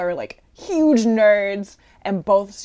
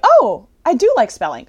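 higher, louder and delighted; a boy discovering he likes spelling; lightly voiced happy kid; pleased kid; 6 semitones higher, 9 dB louder than usual. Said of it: "is like".

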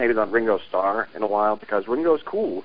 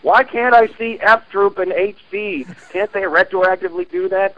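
mock whiny viewer; 8 semitones higher than usual. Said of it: "people going".

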